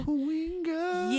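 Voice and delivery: high, soft, drawn-out echo; playful echo. Pretend. Echoing co-host's street name, high playful drawl. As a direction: stretch it out high and softly.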